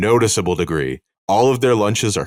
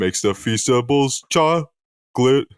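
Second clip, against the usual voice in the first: slow deadpan; 4 semitones higher, slower than usual. Acted. Deadpan corporate Oompa Loompa.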